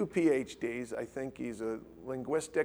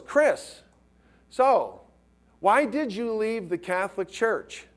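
retold priest's question, Chris pitched way up; probing question; 7 semitones higher, 3 dB louder, slower than usual. Scholar at dinner challenging Chris about leaving the Church, retold with a high sharp opening. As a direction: fire the first salvo at him. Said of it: "He goes".

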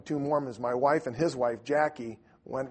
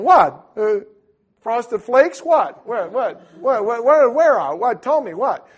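loud, high, frantic mock confusion; startled panic; 10 semitones higher, 14 dB louder, slower than usual. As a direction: fire off bewildered questions at a shout. Startled husband hunting around store.

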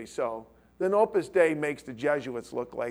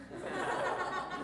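quiet laugh; 9 dB quieter than usual. Real, not acted.

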